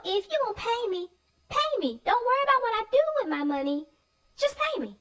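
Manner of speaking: high, fast sassy comeback; a sassy child mocking the farmer, high and fast; mock retort. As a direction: fire it back like a cheeky kid. Voicing the kids' retort.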